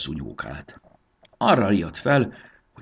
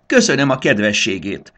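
rustling voice; 4 semitones higher, 7 dB louder than usual. A narrator's reading. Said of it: "zizegte a megszólított".

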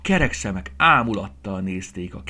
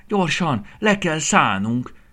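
high, loud, slow urgent plea; hurry, we must land now; excited urging; 8 semitones higher, 7 dB louder, slower than usual. A narrator's reading. Piglet urging the fairy to land, high and excited. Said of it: "Turcsi izgatottan szólt".

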